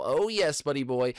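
usual speaking voice

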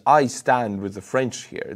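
normal talking voice

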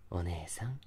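soft creepy whisper. Acted. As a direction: whisper her name close. Whisper after apology.

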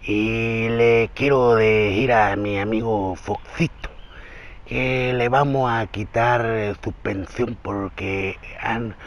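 very slow, soft AMLO impression; habla lenta. Shown as a demonstration. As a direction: drag each phrase out quietly with pauses. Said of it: "Él habla lento, así como de". (imitación muy lenta, baja y pausada)

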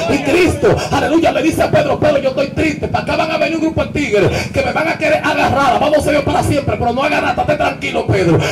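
loud, punchy sermon storytelling over music; fiery preaching. Acted. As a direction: hammer the story home to the congregation.

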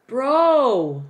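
high, loud drawn-out bro; loud stretched cry of amazement; amazed. Real, not acted. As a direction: belt it out in disbelief. Amazed by exam preparation page.

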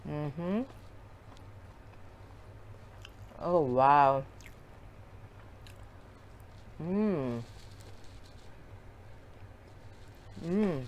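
talking while chewing food; muffled mouth-closed chewing murmur; mouth full, chewing. Real, not acted. Seen 0: Holds, bites and chews potato skin piece throughout labelled words.

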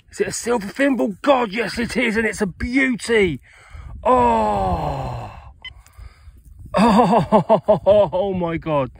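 high-pitched, thrilled discovery; real elation. Real, not acted. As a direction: gasp and exclaim over a wonderful find, high and overjoyed. Delighted at finding a silver thimble.

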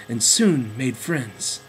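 plain reading voice

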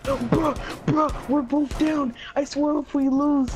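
high, loud, frantic protest; panicked yelling. Real, not acted. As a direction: yell in real panic. Both downed, round at stake.

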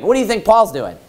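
ordinary delivery

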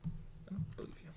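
whispered doubt, voice gone. Real, not acted. Friend doubts the crying scene, whispering. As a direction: breathe it out, unconvinced. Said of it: "whispered disbelief".

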